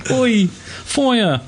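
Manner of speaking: high, hammed-up Cockney callout; playful fake Cockney in a game lobby; mock Cockney accent. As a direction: toss off a jokey Cockney "Oi". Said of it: "I was making people do Cockney accents".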